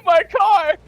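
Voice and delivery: high, loud cry over the car; hammed-up high-pitched lament; mock wail. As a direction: wail it in playful dismay. Playfully wails over damaged car.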